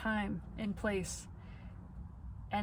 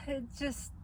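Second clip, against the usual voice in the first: ordinary delivery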